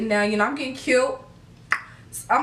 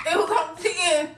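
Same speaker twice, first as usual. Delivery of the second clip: higher, giggling as she repeats it; giddy laughing repeat; 8 semitones higher than usual. Real, not acted. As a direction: laugh it out, overexcited. Repeats heart racing line.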